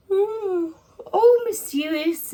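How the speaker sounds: high, drawn-out mock spooky ooh; mock mystery. Psychic mocking yet another mysterious car park fire, mock intrigue.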